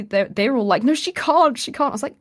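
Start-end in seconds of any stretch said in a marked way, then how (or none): 0.8-1.9 high-pitched mock distress; raised voice, acting upset; half in tears. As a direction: imitate onlookers pleading, near tears. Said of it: "like, half in tears".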